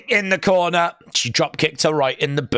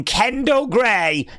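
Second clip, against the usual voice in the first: ordinary delivery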